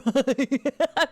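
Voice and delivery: rising high laugh. Real, not acted. Streamer laughs at her vocal fry joke, high laugh. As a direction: laugh out, pitch climbing.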